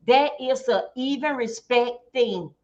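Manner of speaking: usual speaking voice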